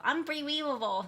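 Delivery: put-on Unbelievable with a Chinese accent, syllables blurred like aimpriwivable. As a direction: say Unbelievable in a light Chinese accent, like her mom.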